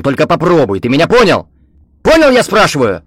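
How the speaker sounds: furious, loud and high threat; angry shout. Acted. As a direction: bellow the threat in his face. Victor threatening the teacher.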